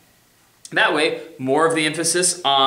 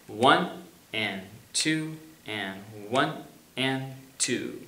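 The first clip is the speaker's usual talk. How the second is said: slow, soft count, one and two; 2 semitones lower, 8 dB quieter, much slower than usual. Shown as a demonstration. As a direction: count them in slowly and gently. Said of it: "Here we go".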